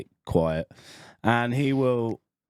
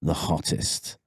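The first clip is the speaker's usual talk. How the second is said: Johnny Hammers; 7 semitones lower than usual. Retold or imitated. Coworker correcting his desk mate, low and insistent. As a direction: imitate a colleague insisting in a low voice. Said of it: "He goes".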